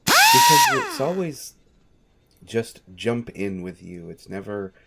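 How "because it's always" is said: much higher and louder, a squealing scold; high, loud exasperated cry; exasperated outburst. Acted. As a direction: throw up your hands and squeal it. Exasperated scolding of reckless master.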